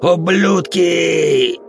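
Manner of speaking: drawn-out raging yell; furious shout. A narrator's reading. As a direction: scream the curse slowly. Drugged bandit curses captors.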